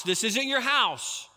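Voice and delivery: usual speaking voice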